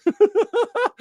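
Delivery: sudden high cackle; high burst of laughter. Real, not acted. Laughing at his own mock chat quote, high cackle. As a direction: break into a squeaky laugh.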